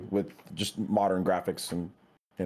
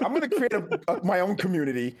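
imitating his past self; 7 semitones higher than usual. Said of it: "I'm like".

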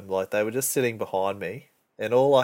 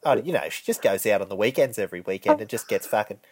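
imitating the blunt coworker; faster than usual. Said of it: "the other one's like".

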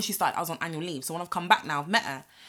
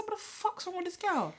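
baffled disbelief; 9 semitones higher, 12 dB quieter than usual. Retold or imitated. Inner reaction to odd coworker.

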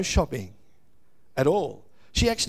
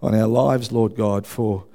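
lower, steady prayer voice; 4 semitones lower than usual. Real, not acted. Pastor opening the service in prayer, humble and steady. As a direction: pray humbly over the congregation.

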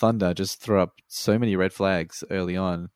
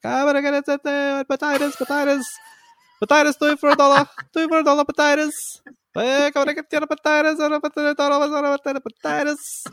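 put-on much higher, loud Greek-accented fruit seller calling out; Greek accent market yell; 6 dB louder than usual. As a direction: hawk potatoes like a Greek market vendor, thick Greek accent. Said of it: "Greek dudes who are selling it in their accents, yelling shit out".

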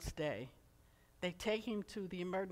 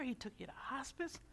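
whisper; 4 semitones higher, 7 dB quieter than usual. Retold or imitated. Chaplain's words fading to whisper.

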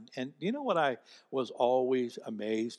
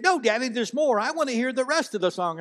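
put-on high, pleading child's voice; imitating son insisting, pitched up; insistent child; 11 semitones higher, 8 dB louder than usual. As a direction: push back like a stubborn little boy.

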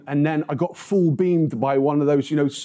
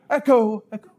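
playful raised call of Echo, echo; 4 semitones higher, 4 dB louder than usual. Pretend. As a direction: call Echo, echo out loud and high. Shouting in the library.

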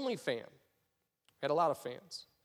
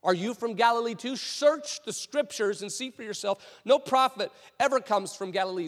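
higher, emphatic scornful retort; belittling; 4 semitones higher, 3 dB louder than usual. Acted. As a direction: put the upstart in his place. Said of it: "They're belittling him".

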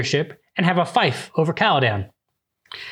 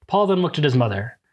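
ordinary talk

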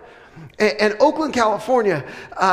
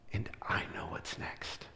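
whisper; 19 dB quieter than usual. Acted. God speaking gently.

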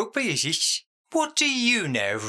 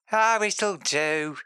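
normal talking voice

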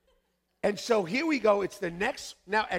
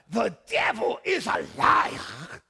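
very slow, loud Sierra Leonean proclamation, drawn out; retry the line in a heavier Sierra Leone accent; a Sierra Leonean church friend rebuking the devil; 2 semitones higher, 6 dB louder, much slower than usual. Retold or imitated. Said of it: "so that's my best".

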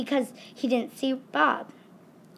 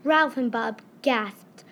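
usual speaking voice

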